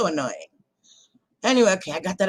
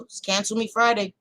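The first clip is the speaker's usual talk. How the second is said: usual speaking voice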